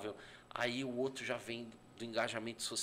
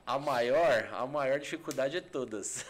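laughing; 3 semitones higher, 7 dB louder than usual. Real, not acted. Joking answer, laughs.

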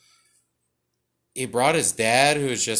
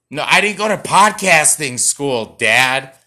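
put-on loud, high teenage outburst; loud mock protest at Dad; mock yell at dad; 3 semitones higher, 10 dB louder than usual. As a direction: snap back at Dad like a sulky teen. Shouting at an imagined dad.

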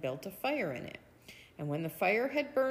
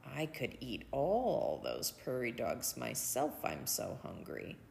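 soft, lower hungry musing; inner thought; 7 semitones lower, 5 dB quieter than usual. Acted. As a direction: think it to yourself, softly. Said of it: "he thought".

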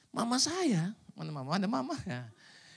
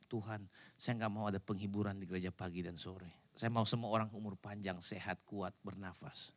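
1 soft quoted prayer; 12 semitones lower, 13 dB quieter than usual. Retold or imitated. Quoting his own prayer.

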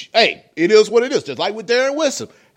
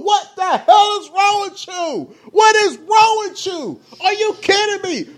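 raging gamer; 9 semitones higher than usual. Real, not acted. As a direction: yell at the screen in outrage. Outraged rant at the courtroom hug.

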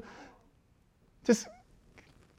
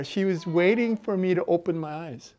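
normal talking voice

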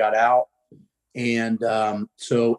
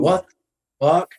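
slow, higher-pitched imitated shock; stunned disbelief; 5 semitones higher, 3 dB louder, much slower than usual. Lifter learning the big kid is in sixth grade, stunned. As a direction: draw it out in mock disbelief.

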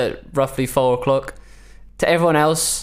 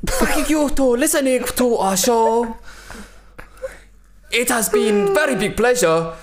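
put-on much higher, broken-English Japanese accent; 10 semitones higher than usual. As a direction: sign off in a bad Japanese accent. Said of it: "Two of the worst Japanese accents".

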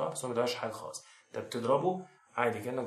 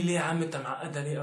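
mock objection; 5 semitones higher, 5 dB louder than usual. Viewer's imagined objection voiced.

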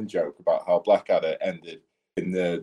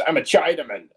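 higher, daft announcement in disguise; silly disguise act; 8 semitones higher than usual. Retold or imitated. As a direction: blurt it as a fool in a disguise. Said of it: "puts something on his face and just goes".

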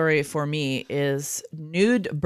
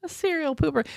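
amused; 11 semitones higher than usual. Real, not acted. Amused echo of the quote.

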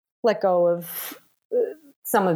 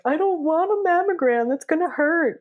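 put-on much higher, babyish whine about the mammogram; whiny like a baby; 12 semitones higher than usual. To the nurses, dreading the mammogram, babyish whine. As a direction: whimper it like a scared little kid. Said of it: "a little bit of a baby".

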